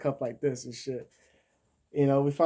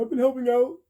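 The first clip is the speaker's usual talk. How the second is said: high, loud comic impression; Richard Pryor; 10 semitones higher, 6 dB louder than usual. Defendant telling the judge he did good, as Richard Pryor. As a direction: pitch it way up, pleading your good deeds. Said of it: "What did Richard Pryor say?".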